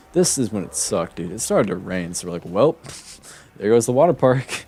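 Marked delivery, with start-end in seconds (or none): 2.4-4.7 rueful laugh breaking through, louder. Real, not acted. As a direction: laugh at the bad luck. Rain ruins the water park trip.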